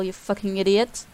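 ordinary delivery

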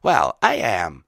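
loud, slow, proud declaration; hard, proud and drawn out; proud defiance. A narrator's reading. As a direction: own it proudly and loudly. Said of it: "went from guilty and nervous to hard and proud".

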